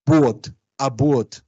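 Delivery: BUOT, ABUOT with 'uo' glide; slow, separated 'bwot, abwot'; Creole diphthong. Shown as a demonstration. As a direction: say BUOT with 'u' sliding into 'o'. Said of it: "va a ser en criollo uo".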